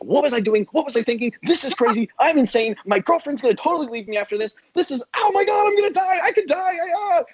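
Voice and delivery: high, frantic voice of panic at the open door; mock panic. Retelling the skydive to the host, in high mock panic. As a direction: squeal it out like you're about to jump. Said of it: "You're like".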